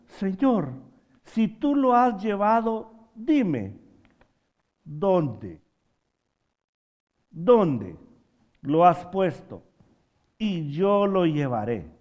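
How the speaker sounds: loud, drawn-out preacher's plea; dramatized plea. Retold or imitated. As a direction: plead it out slowly to the congregation. Preacher acts out Mary's plea.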